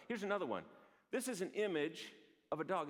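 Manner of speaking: usual speaking voice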